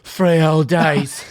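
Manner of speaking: slow, drawn-out Cockney tough guy; Jason Statham. Acted. Star boasting of holding his pee, slow mock Cockney. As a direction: stretch the vowels, mock Cockney. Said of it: "hit her with the Jason Statham".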